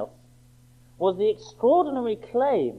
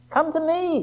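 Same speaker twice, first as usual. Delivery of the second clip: emphatic high quote; 11 semitones higher than usual. Acted. Quoting Jesus again, emphatic.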